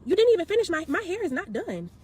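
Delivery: a touch of a retold shout at the stylist; retold outrage. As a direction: push back hard, voice rising. Arguing back at stylist.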